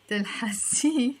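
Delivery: تلحسي ending clearly on a final e. Shown as a demonstration. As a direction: lean on the final vowel of تلحسي. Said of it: "with the e in the end".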